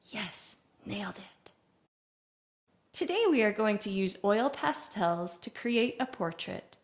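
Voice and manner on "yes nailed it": quick private whispered aside, pleased with the take; whispered self-congratulation. Pretend. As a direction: whisper it to yourself. Self-congratulation after restarting the greeting.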